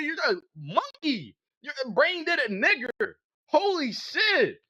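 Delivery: high-pitched, incensed tirade; exasperated angry rant. Real, not acted. Debater berates opponent for misunderstanding parsimony, exasperated high rant. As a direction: rant at them with the pitch pushed way up.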